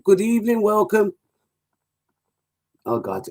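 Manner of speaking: normal talking voice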